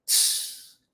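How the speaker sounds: voiceless tss like a cymbal; cymbal hiss. Shown as a demonstration. Teacher imitating a cymbal, voiceless tss. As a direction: hiss it out like a hi-hat. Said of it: "aquele prato da bateria".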